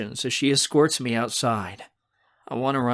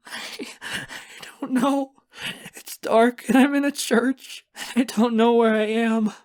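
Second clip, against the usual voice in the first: high, gasping panic; sobbing terror; 12 semitones higher, 4 dB louder than usual. A narrator's reading. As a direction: cry it out lost and terrified. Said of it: "I look around me in absolute terror".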